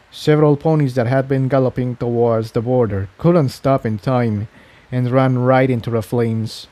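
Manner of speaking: ordinary delivery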